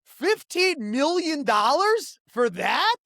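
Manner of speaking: slow, high, loud disbelief; incredulous. Real, not acted. Complaining about the ad spend, slow incredulous question. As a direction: drag out the question in outrage. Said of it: "really pissed me off".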